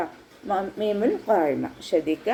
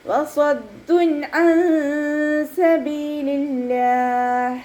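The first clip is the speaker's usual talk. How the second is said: slow, drawn-out, higher chanted recitation; Quran recitation; 6 semitones higher, much slower than usual. Shown as a demonstration. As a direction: recite it slowly with tajweed. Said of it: "നൂനിൻ്റെ sound വ്യക്തമായിട്ട് കേൾക്കണം".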